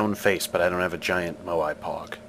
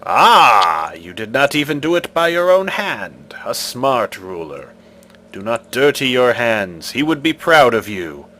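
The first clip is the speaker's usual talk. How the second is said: commanding stone guardian, louder; stone face voice; 2 semitones higher, 7 dB louder than usual. Acted. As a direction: demand an answer like an ancient idol.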